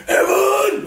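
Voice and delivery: high, imitated yell of his mother; mum's yell. Woken mum yelling for her son, high mock yell. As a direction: holler the name high like an angry mum.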